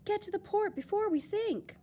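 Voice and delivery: high urgent captain's call; frantic captain. Acted. Captain orders crew to port before sinking, high and urgent. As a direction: yell it above the storm.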